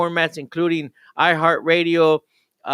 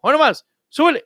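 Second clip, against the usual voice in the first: excited shout; 10 semitones higher, much faster than usual. Real, not acted. Hype call to listeners.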